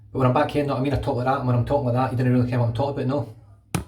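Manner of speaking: rapid Glasgow patter, no space between words; fast back-home Glaswegian. Shown as a demonstration. Showing viewers how they talk back home, fast Glaswegian. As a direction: run the words together quickly, without a breath. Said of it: "That's how fast I normally talk".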